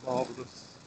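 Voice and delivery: ordinary talk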